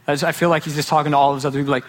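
ordinary delivery

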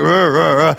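imitated chunky-baby grunts; baby's grunting. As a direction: grunt in place of talking. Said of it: "He would grunt".

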